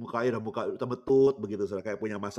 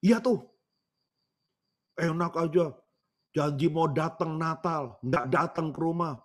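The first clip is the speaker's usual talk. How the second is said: imitated grumbling old woman, pitched up; mock-cross, raised granny voice; angry granny; 4 semitones higher, slower than usual. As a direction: complain crossly like a granny let down by her child. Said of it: "agak-agak marah begitu, seperti orang marah".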